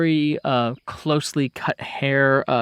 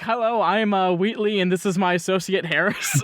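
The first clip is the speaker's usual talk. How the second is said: mock lawyer intro; 6 semitones higher than usual. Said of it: "I thought you were going to be like".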